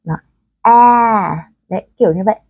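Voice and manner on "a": a long tense A, halfway to e; căng, nửa a nửa e. Shown as a demonstration. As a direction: stretch A with the mouth pulled tight. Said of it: "căng miệng ra và chúng ta làm nó hơi nửa nọ nửa". (âm A căng, kéo dài)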